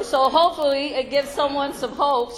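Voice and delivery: normal talking voice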